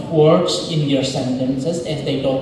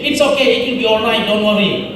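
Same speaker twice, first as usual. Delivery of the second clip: quick bright reassurance, higher; wrong way, dismissive; 5 semitones higher, faster than usual. Shown as a demonstration. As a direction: brush off the worry cheerfully. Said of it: "Don't say like that".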